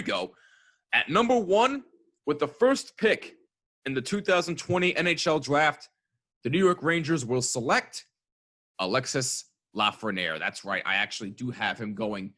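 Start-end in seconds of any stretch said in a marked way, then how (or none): 0.9-10.4 draft announcer. Acted. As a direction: announce the pick like the commissioner. Mock draft pick announcement.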